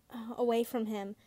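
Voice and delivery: plain reading voice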